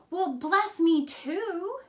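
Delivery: high, loud, drawn-out acted plea; heartbroken pleading. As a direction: imitate a heartbroken son begging, without tears. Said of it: "he started to cry".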